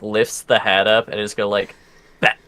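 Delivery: ordinary talk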